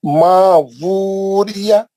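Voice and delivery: मा वूरिया with a clear w before the oo, slowly; correct pronunciation. Shown as a demonstration. Teacher demonstrates the correct reading to students. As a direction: sound the व fully in वूरिया. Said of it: "वो डब्ल्यू से पढ़ना".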